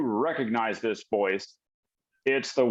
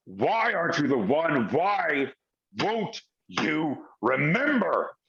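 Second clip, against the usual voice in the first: mock yell, held back and deliberate; angry villain yelling. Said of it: "you hear the voice yelling".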